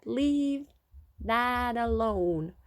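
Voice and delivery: put-on very slow, drawn-out American accent; attempted American accent. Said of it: "It was American, I think".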